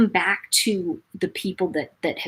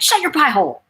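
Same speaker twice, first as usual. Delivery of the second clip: higher-pitched mock retort; retold mean retort; 8 semitones higher, 3 dB louder than usual. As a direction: fire back a rude comeback, half joking. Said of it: "I went".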